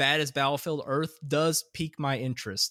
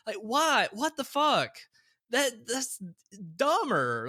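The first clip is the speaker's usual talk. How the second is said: high, incredulous outburst; can't believe how dumb it is; exasperated disbelief; 8 semitones higher, slower than usual. Real, not acted. Ranting about the movie change.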